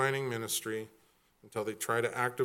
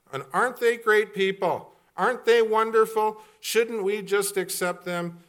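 higher, louder, sarcastic gushing; mock praise; 9 semitones higher, 8 dB louder than usual. Preacher mocking the church's love of flatterers, sarcastic. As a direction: sell the irony, slowing on the last line.

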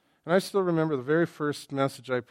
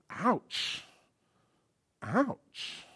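high, wounded ouch; hurt Peter; 6 semitones higher, 4 dB quieter than usual. Acted. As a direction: wince like you were stung.

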